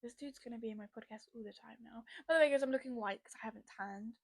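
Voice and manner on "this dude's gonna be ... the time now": hushed whisper to the side; whispered aside. Real, not acted. Podcaster whispering an aside about a friend, hushed.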